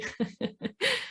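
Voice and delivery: a soft amused giggle; light high laugh. Real, not acted. Speaker giggling softly after the child voice.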